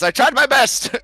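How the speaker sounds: high, loud put-on little voice; Macy shrugging after the chicken fiasco; Macy apologizing. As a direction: offer a sheepish excuse. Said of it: "Macy says".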